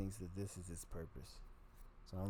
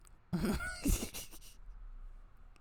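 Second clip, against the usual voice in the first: a squealing, breathless laugh that breaks up high; cracking up in a thin squeal; high wheezy laugh. Real, not acted. Comic laughs at his own confession with a high squeal.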